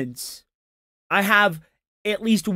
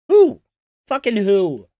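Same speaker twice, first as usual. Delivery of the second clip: outraged shout; 2 semitones higher, 7 dB louder than usual. Real, not acted. Streamer yelling at a clip in disbelief, loud.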